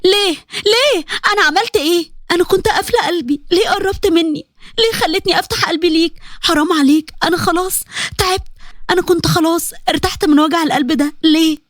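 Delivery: high-pitched, anguished lament without real volume; a betrayed woman's high wail, held back in volume; mock wailing. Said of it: "وقعدت تصوت".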